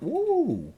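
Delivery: high, loud whooping "woo"; swooping high whoop, falling at the end; trippy "woo". Retold or imitated. Describing his jungle trip, imitated trippy whoop.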